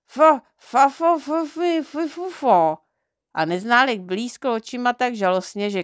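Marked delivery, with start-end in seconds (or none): 0.1-2.8 louder, higher f-sounds forced past a wand in the teeth; muffled mumbling through a wand. Acted. Neználek, wand in mouth, answers Knoflenka in desperate muffled f's. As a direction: push the words out but only f's get past. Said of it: "nerozuměla ani slovo".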